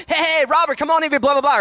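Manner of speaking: high-pitched comic demo-reel voice; hammed-up funny guy calling out; funny character bit. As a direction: ham up a high, comic greeting. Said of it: "I'm, like, doing my funny".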